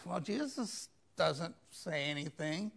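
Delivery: ordinary talk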